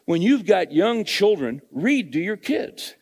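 loud, high, driving pulpit voice; fired-up preacher hammering his point home; full-force preaching. Acted. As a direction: preach it hard to the congregation.

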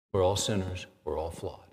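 ordinary talk

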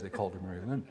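usual speaking voice

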